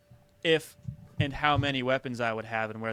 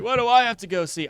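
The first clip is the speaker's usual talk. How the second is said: higher, louder whiny protest; mock reluctance; 8 semitones higher, 7 dB louder than usual. Sent to check a noise, higher louder whine. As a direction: complain about being sent to check. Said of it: "I'll be like".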